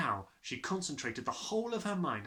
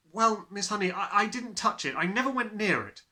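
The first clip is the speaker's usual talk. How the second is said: higher and louder, stammering; insistent child; 8 semitones higher, 6 dB louder than usual. Acted. Matilda insisting to Miss Honey, higher and louder. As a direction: blurt out the denial.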